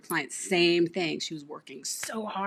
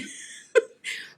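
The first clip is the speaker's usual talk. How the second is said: breathy laugh. Real, not acted. Laughing at own sassy voice.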